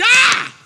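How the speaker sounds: high startled cry of shock "Ah!"; sudden loud shriek of surprise; retold scream. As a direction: yelp out in amazement. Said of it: "he screamed".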